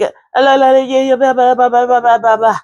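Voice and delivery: playful high-pitched blah blah babble; baby babble. Retold or imitated. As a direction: babble like a baby again, loud and bright. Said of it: "like that baby who was like".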